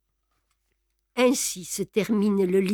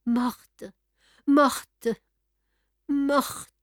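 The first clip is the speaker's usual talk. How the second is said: dazed repetition; 4 semitones higher, much slower than usual. A narrator's reading. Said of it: "d'un ton réfléchi comme s'il ne comprenait pas encore".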